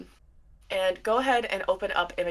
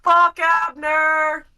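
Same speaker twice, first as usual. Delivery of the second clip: high, slow, drawn-out yell; screamed insults; 10 semitones higher, 5 dB louder, much slower than usual. Acted. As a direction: stretch each taunt into a high holler. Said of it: "he's just screaming".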